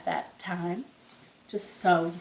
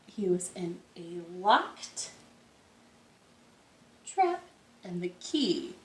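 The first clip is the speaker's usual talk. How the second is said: softer, slow bedtime narration; hushed storytelling; 3 semitones lower, 5 dB quieter, much slower than usual. A narrator's reading. Teacher reading a folk tale to the children, hushed.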